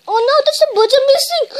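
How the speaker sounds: high, loud and fast alarm; panicked cry. Acted. Gordon spots trouble on the hill, panicked and fast. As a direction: blurt it out in a rush of panic.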